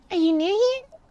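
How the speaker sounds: put-on high-pitched curious child; high little kid, rising question. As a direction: ask like a curious nine-year-old. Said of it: "I'm reading in this kid voice".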